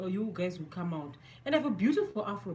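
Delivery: normal talking voice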